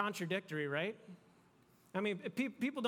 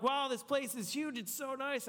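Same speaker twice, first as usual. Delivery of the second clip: higher, impressed visitor gushing; mock wow at a friend's fancy house; impressed guest; 6 semitones higher than usual. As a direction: gush over the big house. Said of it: "and you're like".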